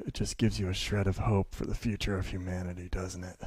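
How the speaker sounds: low, soft mutter in passing; retold mutter. Miserable passer-by to the speaker, muttering as he passes. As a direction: mutter it wearily under your breath, imitating him. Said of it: "he kind of mutters to me".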